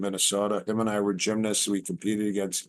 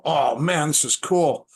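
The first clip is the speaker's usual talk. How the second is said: excited; 6 semitones higher, 5 dB louder than usual. Retold or imitated. Excited teen at the gym door.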